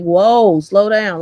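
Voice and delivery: loud, high "whoa, slow down" at a reckless driver; alarmed shout. Retold or imitated. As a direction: yell at the driver to ease off. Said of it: "So we like".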